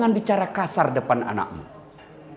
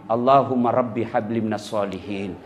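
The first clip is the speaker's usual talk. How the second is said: doa lembut untuk anak; 9 semitones lower than usual. Shown as a demonstration. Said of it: "kita usap kepalanya".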